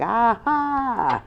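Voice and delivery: high taunting "ah, ha"; playful mocking laugh. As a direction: laugh at them in their face. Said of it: "You like".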